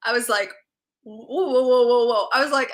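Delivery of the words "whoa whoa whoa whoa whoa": rising, louder stunned whoas. Retold or imitated. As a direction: pull back in mock shock. Stunned reaction to friend's news.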